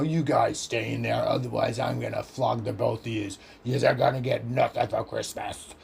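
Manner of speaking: a gruff, threatening auntie voiced low; mock stern relative warning the kids; 1 menacing adult. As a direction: growl the threat like a fed-up adult.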